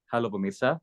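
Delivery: greeting at a relaxed low pitch. Shown as a demonstration. Coach shows trainee the formal tone, low register. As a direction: settle the voice down for the greeting. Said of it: "coba Mas Muslimin pakai nada bawah".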